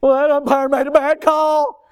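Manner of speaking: high, loud petty complaint; whining fan. Retold or imitated. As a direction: mimic the sore parent at the ballgame. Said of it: "They deteriorate".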